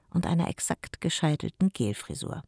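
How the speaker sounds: plain reading voice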